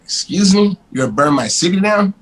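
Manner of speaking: high, loud incredulous retort; outraged disbelief. Retold or imitated. Retelling his reply to an arson threat, with outraged disbelief. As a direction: throw it back at them, stunned and offended.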